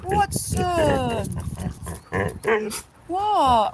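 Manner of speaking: high, loud put-on yappy dog voice; dog's Jack talk. Groomer imitating how Willow does Jack's talk, high and loud. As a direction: squeak it out like a little dog talking. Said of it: "her version of Jack talk".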